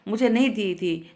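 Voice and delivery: ordinary delivery, nothing marked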